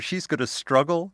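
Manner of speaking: ordinary talk, nothing marked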